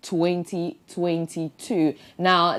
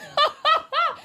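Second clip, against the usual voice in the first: high squeaky laugh. Real, not acted. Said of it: "That's funny".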